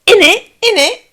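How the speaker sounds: a scandalised mother throwing the word back; mocking strict mother. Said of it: "make you sound like you're from the back ends of Winsford".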